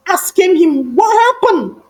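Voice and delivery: ordinary delivery, nothing marked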